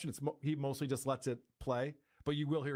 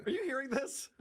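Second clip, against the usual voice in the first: mock outrage; 12 semitones higher than usual. Real, not acted. Mock outrage at hire offer.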